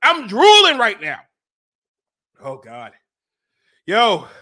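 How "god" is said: ordinary talk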